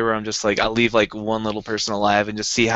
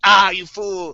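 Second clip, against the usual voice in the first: mock villain gloat; 7 semitones higher, 4 dB louder, much slower than usual. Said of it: "It's like".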